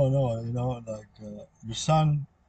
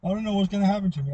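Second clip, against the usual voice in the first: mock panic; 6 semitones higher than usual.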